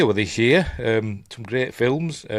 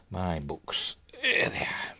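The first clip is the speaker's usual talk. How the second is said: "My books" repeated much softer; quiet aside; 4 semitones lower, 11 dB quieter, much slower than usual. Real, not acted. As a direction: murmur "My books" to yourself, dropping the volume.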